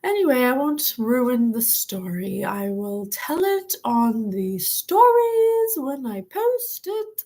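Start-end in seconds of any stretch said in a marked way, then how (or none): 2.4-7.2 slow, drawn-out sing-song voice with a high lift at the end; drifting into a slow, oddly put-on voice. Real, not acted. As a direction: stretch the words out in a strange lilting voice. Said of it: "I don't know why I'm talking like that".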